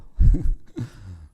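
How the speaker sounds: loud burst of real laughter; amused laugh. Real, not acted. Father recalling his children's remark, laughing loudly.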